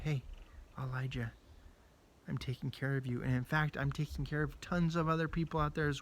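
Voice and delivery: soft, calm God comforting a scared Elijah; gentle God voice. Acted. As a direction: speak like a gentle breeze. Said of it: "God came to him in a gentle breeze".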